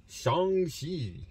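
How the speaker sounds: Shang-Chi with "sh", stretched for emphasis; proper pronunciation. Shown as a demonstration. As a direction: say Shang-Chi slowly, the movie star's way. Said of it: "That's the proper way to say it".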